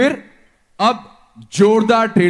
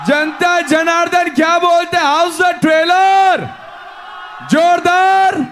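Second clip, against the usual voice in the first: higher, raised crowd call; emcee hype; 7 semitones higher than usual. Real, not acted. Host asking the audience, hype call. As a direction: throw it out to the crowd.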